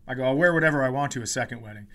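ordinary talk, nothing marked

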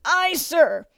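high, strained shout back; recruit's reply. A narrator's reading. Recruit to bellowing drill master, strained shout.

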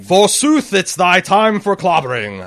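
big theatrical Shakespearean boom; hammy stage actor. Pretend. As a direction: declaim it like a ham actor. Mock archaic Valyrian line.

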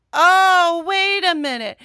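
high, loud, drawn-out double take; surprised outburst as the penny drops; sudden realization. Retold or imitated. Listener retelling the moment an accent fooled her, surprised. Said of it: "took me a second to realize".

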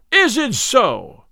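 slow, loud, high-pitched angry challenge; in a rage. A narrator's reading. Little John enraged at the tanner, slow furious cry. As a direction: drag it out, seething with rage. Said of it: "cried Little John in a rage".

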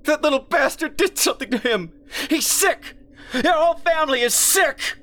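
high, loud tearful fury; a grieving mother accusing; screaming through tears. Acted. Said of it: "screamed through tears".

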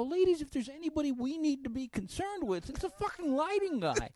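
loud, high, incredulous pleading; exasperated argument. Retold or imitated. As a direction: argue his case to outraged moms. Retells arguing with the studio moms.

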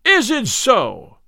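loud, high, slow indignant cry; enraged cry. A narrator's reading. Little John answers the tanner's defiance, enraged. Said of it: "cried Little John in a rage".

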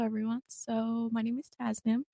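soft, barely projected introduction; too quiet. Real, not acted. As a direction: say your name softly, like you're shy. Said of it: "Just speak up a little bit".

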